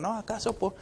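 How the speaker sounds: mildly mocking rhetorical question, pitched high; lightly acted irreverent objection; irreverent challenge.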